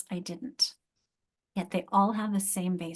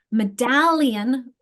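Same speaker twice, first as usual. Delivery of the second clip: "Medallion" slowly, with a loud, stretched DAL; slow teacher demo of me-DAL-lion in "Medallion"; second-syllable stress; 2 semitones higher, 7 dB louder, much slower than usual. Shown as a demonstration. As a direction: lean hard on DAL in "Medallion". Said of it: "where's my stress?".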